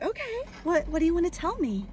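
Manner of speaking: high, sing-song question; humouring a child. Retold or imitated. Party princess asking birthday girl for her secret, high humouring voice. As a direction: pitch it up sweetly for a little girl. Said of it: "So I'm like".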